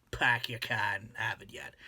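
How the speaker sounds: stock Boston phrase in dropped r's; Boston accent. Shown as a demonstration. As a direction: say it in a low-key Massachusetts accent. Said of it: "everybody says that same old".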